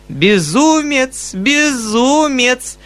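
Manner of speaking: high, very slow coquettish teasing; playful repeat. Acted. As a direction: sing it out to tease him. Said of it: "повторяла Татьяна Ивановна и вдруг захохотала".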